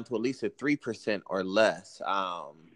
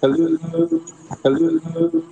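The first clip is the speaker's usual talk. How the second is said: echoing tunnel; 12 dB louder, slower than usual. Real, not acted. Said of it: "sounds like you're in a tunnel".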